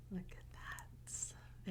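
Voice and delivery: very soft, slow whisper. Real, not acted. Reader closing the book, soft slow whisper. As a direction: hush it out slowly.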